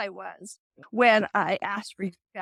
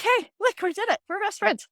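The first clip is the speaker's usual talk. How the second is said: bouncing with glee at a small win; so excited; 10 semitones higher than usual. Retold or imitated. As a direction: squeal it like you just won. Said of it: "I got so excited".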